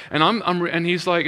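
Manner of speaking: ordinary talk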